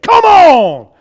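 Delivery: loud preacher-style holler; revival shout. Retold or imitated. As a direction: holler it like a fired-up churchgoer. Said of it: "heard a Pentecostal man scream".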